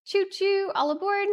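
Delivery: high sing-song conductor call; chugging toy train voice. Pretend. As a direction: call all aboard like a cheery conductor. Streamer train hype call.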